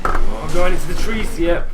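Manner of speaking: sudden real in-game alarm; alarmed outburst. Real, not acted. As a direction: blurt it out loudly as you lose control. Car veers off into trees.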